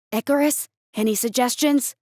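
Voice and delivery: plain reading voice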